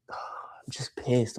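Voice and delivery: slow sighing whisper; exasperated whisper. Real, not acted. Streamer admitting to chat he is just pissed, sighing whisper. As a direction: let it out on a tired breath.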